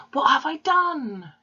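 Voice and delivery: high, loud, drawn-out wail of distress; a hammed-up sob after a gasp; sobbed. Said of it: "she sobbed".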